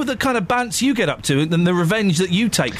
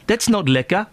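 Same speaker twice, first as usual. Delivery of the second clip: mock-disapproving South African accent; 4 semitones higher than usual. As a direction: scold lightly in South African. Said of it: "I'm doing a South African".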